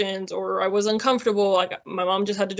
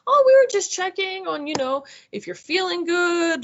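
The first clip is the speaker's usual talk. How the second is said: a high, lightly voiced chirpy nurse; mock sing-song checking-in voice; imitating the nurse; 9 semitones higher than usual. Nurse checking in on a patient at night, lightly mocked and high.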